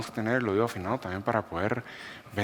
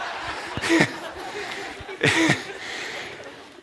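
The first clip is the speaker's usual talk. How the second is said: long breathy burst of laughter; hearty laugh. Real, not acted. Laughing at himself for giving the car away, a long hearty laugh. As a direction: laugh hard at your own foolishness. Laughs at imagining his loss.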